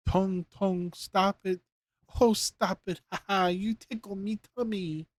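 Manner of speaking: high, ticklish giggle; giggling tiny voice. A narrator's reading. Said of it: "a voice giggled".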